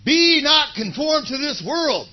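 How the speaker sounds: loud, slow, high call to repeat; preacher calling the room to say it with him; leading the congregation. Shown as a demonstration. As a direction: proclaim it for all to echo. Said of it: "Let's say it together".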